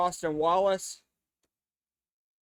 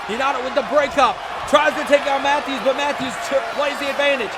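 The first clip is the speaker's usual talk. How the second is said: fast, high, shouted play-by-play; excited shout; 7 semitones higher, 6 dB louder, faster than usual. Real, not acted. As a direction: call the action at full roar. Live wrestling play-by-play.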